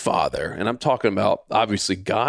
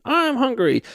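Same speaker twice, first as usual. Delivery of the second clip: put-on whiny kid; 13 semitones higher than usual. Imitating whining son.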